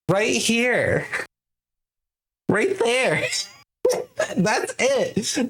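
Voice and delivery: squeaky high right here; cutesy teasing voice; high mocking. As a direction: pitch it way up to mock. Mocking tiny card.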